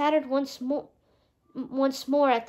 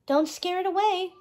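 fretting fairy calling ahead; worried cry; 6 semitones higher than usual. A narrator's reading. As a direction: call out urgently not to frighten it. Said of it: "cried Fauna".

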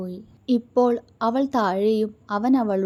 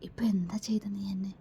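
playful teasing whisper, softer; mischievous whisper; 8 dB quieter, faster than usual. A narrator's reading. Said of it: "കണ്ണിലൊളിപ്പിച്ച കുസൃതിയോടെ". (കുസൃതി നിറഞ്ഞ മന്ത്രണം)

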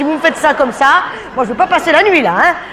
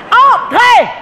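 sudden shout; 9 semitones higher, 4 dB louder than usual. Acted. Waiter losing patience with the diners, shouting. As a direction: bark "Entrez!" much higher and louder.

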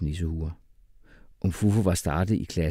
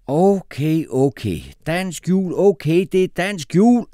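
loud, high exasperated shout; 11 semitones higher, 8 dB louder, slower than usual. A narrator's reading. Teacher Jeppe shouts at the class about Christmas. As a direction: shout it to end the debate. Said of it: "råbte Jeppe".

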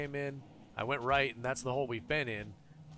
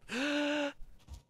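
gasp; 13 semitones higher than usual. Real, not acted. Sees something floating below.

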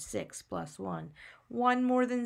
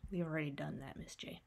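ordinary talk, nothing marked

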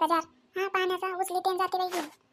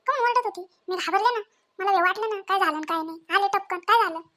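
usual speaking voice

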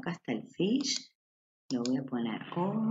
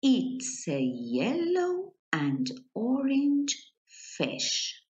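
1 slow reading aloud; 8 semitones higher, much slower than usual. Shown as a demonstration. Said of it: "Ajá".

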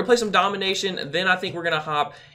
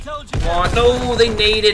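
loud, higher, drawn-out protest; frustrated outburst; 5 semitones higher, 6 dB louder, much slower than usual. Real, not acted. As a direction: cry out at an unfair death. Frustrated at being killed by grenade.

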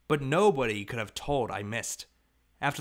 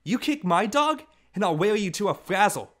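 put-on loud, much higher child's threat; furious threat; 8 semitones higher, 6 dB louder than usual. A narrator's reading. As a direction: threaten him with all your might. Angry child threatens brother.

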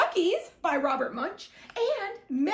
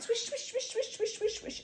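softer swish, swish like rolling dough; 4 semitones higher, 8 dB quieter than usual. A narrator's reading. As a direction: hush each swish. Rolling clay into a ball.

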